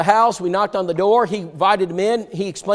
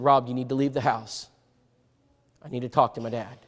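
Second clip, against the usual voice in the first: softer and lower, controlled; quiet urgent; 4 semitones lower, 6 dB quieter than usual. Retold or imitated. Son telling his friend to leave, quiet and urgent. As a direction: calmly send him away.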